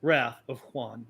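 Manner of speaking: slow, weighty title reading; title announcement. Acted. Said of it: "This is called".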